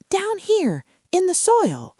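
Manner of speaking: high, small seedling voice; tiny sprout voice. A narrator's reading. Sprout answers giant.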